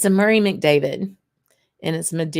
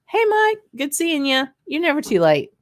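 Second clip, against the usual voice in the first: much higher, louder hello to a friend; excited greeting; 10 semitones higher, 4 dB louder than usual. Real, not acted. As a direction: call out a delighted welcome across the room. Greeting a latecomer.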